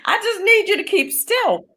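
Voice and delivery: high, exasperated pleading; pleading daughter. Retold or imitated. Daughter begging her mother to keep still, exasperated. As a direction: beg her to stay still, half laughing.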